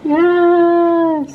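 excited. Real, not acted. Cheering at arrival.

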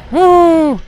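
loud high woo; cheering. Real, not acted. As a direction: whoop in triumph. Cheer after success.

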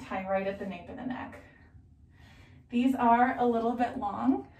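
muffled by fabric over mouth; speaking behind a face covering; through a cloth mask. Real, not acted. Seen 2: Mask ties visible from behind; mask on face from 10s on.

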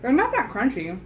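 chewing while talking; speaking around chewy sweets; mouth full of candy. Real, not acted. Seen 3: Left speaker pops candies at -2; cheeks full, chewing throughout.

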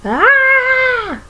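high, loud falling scream. A narrator's reading. Aliens zooming off the cliff, high loud scream. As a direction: scream high as they drop. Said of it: "screamed Jumba and Pleakley".

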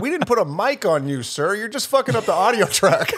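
exasperated pretend set rant; mock scolding. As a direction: tell off an extra, half laughing by the end. Acted annoyed crew scolding an extra.

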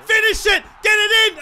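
high, loud urging shout; will the ball through the posts; shouting at the screen in real excitement; excited yell. Real, not acted. Urging player to score.

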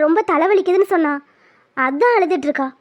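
ordinary talk

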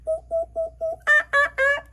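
high monkey ooh ooh ah ah calls; monkey noises. Pretend. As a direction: hoot like a playful monkey.

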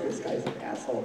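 whispered, under-the-breath insult; muttered aside. Retold or imitated. Audience member judging the comedian, whispered insult. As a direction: mutter it to yourself.

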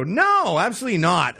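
high, emphatic no; firm refusal. Retold or imitated. Secret Service refusing the coke offer, high emphatic refusal. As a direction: shut it down hard. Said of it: "they would go".

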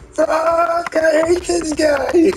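high, loud mock curse, slow at the end; imitating angry opponent. Frustrated rival curses the streamer in a high, loud put-on voice. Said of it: "He said".

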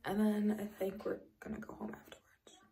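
ordinary delivery

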